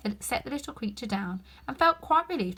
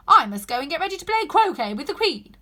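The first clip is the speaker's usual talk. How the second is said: raised voice; 7 semitones higher, 11 dB louder than usual. A narrator's reading. Duchess hurrying out, raised voice.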